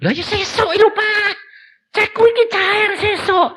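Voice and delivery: high thin son's voice; pleading son. Retold or imitated. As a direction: keep it high and whiny. Son in dialogue.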